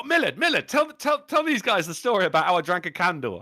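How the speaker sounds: high, loud, eager pleading; eager pub mate. Retold or imitated. Mate urging him to tell the candle story, high and eager. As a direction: beg like an excited drunk mate. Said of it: "he go".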